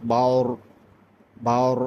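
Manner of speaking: বাওর with a thick, rounded R; heavy R sound. Shown as a demonstration. Teacher correcting how viewers say the R, heavy R. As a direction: say বাওর with full rounded vowel, not বার. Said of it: "বার বললে হবে না".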